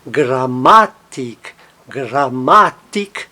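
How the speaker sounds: "Grammatik" slowly, with a long MA and weak tik; stress on MA. Shown as a demonstration. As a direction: lean on the middle ma in "Grammatik". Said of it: "ele é átono".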